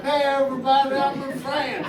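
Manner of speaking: high, loud tipsy hello; drunk camera greeting. Retold or imitated. Drinker greets the camera in France, over-excited.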